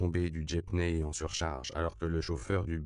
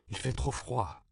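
pitched higher, a sudden complaint; a mild cold exclamation; exclaiming; 6 semitones higher than usual. A narrator's reading. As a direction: blurt out that it's too cold. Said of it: "s'exclama Juan Diego".

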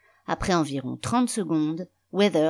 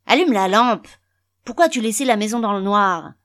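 loud, raised-pitch scolding; angry order; 6 semitones higher, 8 dB louder than usual. A narrator's reading. Father berating his son over the dark house, loud and angry. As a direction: bawl it out as an irritated father.

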